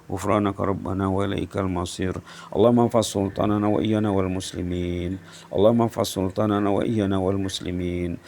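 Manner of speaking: low, quick, even supplication; rapid rote dua, voice dropped; closing Arabic prayer. Real, not acted.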